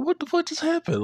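high, stunned squeal; stunned disbelief. Retold or imitated.

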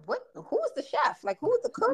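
high, stunned what-happened voice; shocked disbelief. Retold or imitated. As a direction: ask in outraged disbelief. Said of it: "I was like".

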